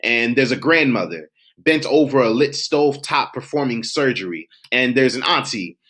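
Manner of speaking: savoring, rhythmic recitation; spoken word poem. Acted. Poet recalling a summer cookout, rhythmic spoken word.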